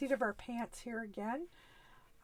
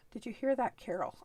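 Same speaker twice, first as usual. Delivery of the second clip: normal talking voice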